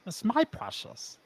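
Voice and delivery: soft, slow, covetous creature voice; Gollum-like creature. Pretend. Playing on his creepy beard look, Gollum-like creature voice. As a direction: hush it like Gollum over the ring.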